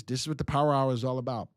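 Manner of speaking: ordinary talk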